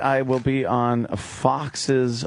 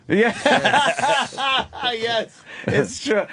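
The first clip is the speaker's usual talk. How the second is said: laughing; 11 semitones higher, slower than usual. Real, not acted. Laughing at impression joke.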